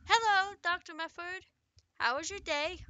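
nurse voice. Acted. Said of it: "said a nurse".

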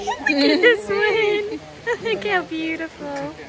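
laughing through the words, slow and high; giggling with delight at a llama. Real, not acted. Vlogger to filming friend about a llama, laughing.

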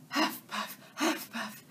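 breathless unvoiced huffing; out of breath. Real, not acted. Detective runs across the field, out of breath huffing. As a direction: pant it out, winded from running. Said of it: "I need more exercise".

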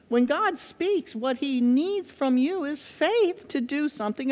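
rising, emphatic pulpit delivery; preaching at full force. Acted. Preacher urging the congregation, emphatic and rising. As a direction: drive it home like a revival preacher.

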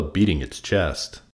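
usual speaking voice

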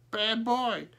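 high-pitched crow learning words; high bird voice, first attempt at speech; talking crow. A narrator's reading. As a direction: squawk it high like a parroting bird. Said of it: "began to be a little like".

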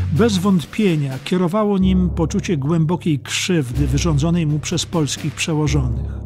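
plain reading voice

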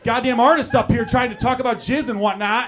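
loud, high, indignant rant; exasperated outburst. Acted. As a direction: yell at the crew backstage in exasperation. Mock outburst at stagehands over noise.

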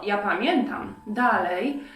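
ordinary delivery

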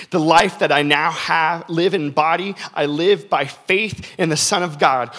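loud, slow, emphatic scripture reading; preaching scripture. Acted. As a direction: proclaim each line to the congregation. Reads scripture with force.

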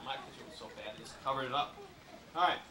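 ordinary talk

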